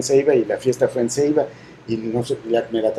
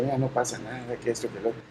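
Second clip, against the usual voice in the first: much softer, gentle comforting; calm her down softly, like hugging her; a much softer fatherly reassurance; soothing reassurance; 13 dB quieter than usual. Retold or imitated. Father soothing crying daughter.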